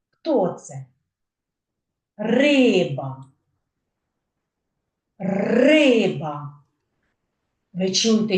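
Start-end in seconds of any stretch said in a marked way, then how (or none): 2.2-3.2 "Риба" with a drawn-out, stressed Ри; stress on first syllable. Shown as a demonstration. Teacher showing children word stress, Ри in "Риба" stressed. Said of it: "перший склад вимовляю голосніше". 5.2-6.6 "Риба" with long, emphatic Ри; stress on first syllable. Shown as a demonstration. Teacher repeats for children, first syllable of "Риба" stressed. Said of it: "перший склад вимовляю голосніше".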